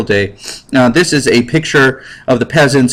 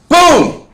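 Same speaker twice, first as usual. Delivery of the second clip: mock gunshot. Guard fires gun at class.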